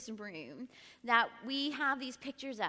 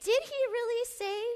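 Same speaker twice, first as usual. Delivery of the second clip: much higher, sly mock voice; mocking serpent; 12 semitones higher, 7 dB louder than usual. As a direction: tease the doubt in, high and sly. Serpent's question again mocking.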